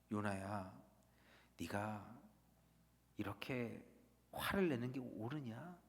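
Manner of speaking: low, soft, tender question; gentle voice of God. Retold or imitated. God gently asking the angry Jonah, soft and low. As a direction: ask Jonah tenderly, no rebuke. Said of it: "따뜻한 손길을 내미세요".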